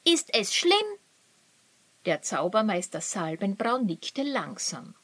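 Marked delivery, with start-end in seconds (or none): 0.0-0.9 hoch und drängend gefragt; will es sofort wissen; ungeduldig. Acted. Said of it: "fragte Luri ungeduldig". (Luri fragt den Zaubermeister ängstlich drängend)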